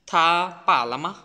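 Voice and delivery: "লামা" with the last syllable lightly let go; teacher's demo, "মা" just said and dropped; pronunciation demo. Shown as a demonstration. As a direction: model "থা, পা, লামা", releasing the final "মা". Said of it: "just উচ্চারণ করে ছেড়ে দিচ্ছি".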